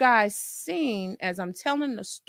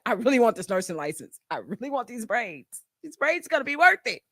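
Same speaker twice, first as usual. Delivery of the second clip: quick, determined inner voice; a nursing student's light, determined self-talk. Retold or imitated. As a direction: tell yourself it will be worth it. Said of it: "you're gonna be like".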